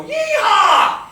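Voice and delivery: very high, full-voiced whoop; cowboy holler. Football players celebrating in the show, screaming cowboy whoop. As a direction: let rip a long screaming cheer. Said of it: "cheering loudly at the top of their lungs, screaming and shouting".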